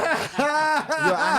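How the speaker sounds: high, loud cackling laugh; loud burst of laughter. Real, not acted. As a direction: crack up out loud. Caught whispering, bursts out.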